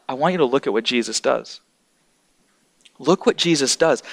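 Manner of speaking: normal talking voice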